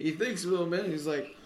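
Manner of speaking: ordinary delivery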